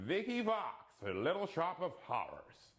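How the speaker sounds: sudden American accent on a name; a light unplanned American accent. Real, not acted. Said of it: "Don't know why I did that in American".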